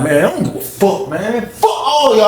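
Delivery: retold angry outburst, pitch climbing; defiant blow-up; snapping. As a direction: lash out at everyone, voice rising. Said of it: "I just started snapping".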